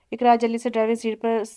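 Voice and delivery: usual speaking voice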